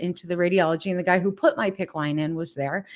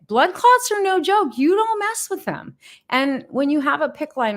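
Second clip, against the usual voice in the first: urgent warning; 8 semitones higher than usual. Real, not acted. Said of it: "warning viewers".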